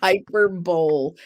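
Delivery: Hyperbole said as hyper plus bowl; mispronounced HYPERBOLE. Shown as a demonstration. Host repeating her mistake for friend, Hyperbole as "hyper-bowl". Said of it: "is what I kept saying".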